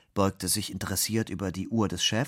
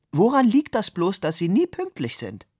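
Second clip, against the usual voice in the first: high, louder silly-colleague voice; 11 semitones higher, 6 dB louder than usual. A narrator's reading. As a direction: pipe up as the silly office mate. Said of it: "der alberne Kollege". (hohe Stimme des albernen Kollegen)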